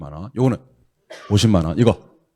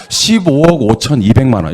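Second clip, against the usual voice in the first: 십오억 hit first, amount falling at the end; emphatic model answer; firm model reading; 3 semitones higher, 7 dB louder than usual. Shown as a demonstration. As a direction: punch 십오억 then run the rest. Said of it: "이렇게 읽으실 수 있어야 됩니다".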